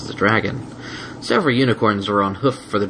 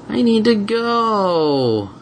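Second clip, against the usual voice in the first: whiny; 12 semitones higher, much slower than usual. Acted. Dragon whining in box.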